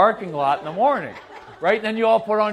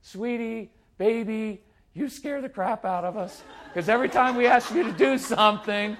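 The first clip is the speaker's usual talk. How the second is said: higher, mock-sweet sing-song; sing-songy mommy voice; 5 semitones higher than usual. Mommy as the strong-willed child hears her, mock sing-songy sweetness. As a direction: parody a cooing mommy. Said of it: "sing-songy mommy voice".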